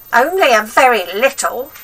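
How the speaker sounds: put-on high, loud cranky old woman; grandma voice. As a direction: nag the boy like a spiteful old grandma.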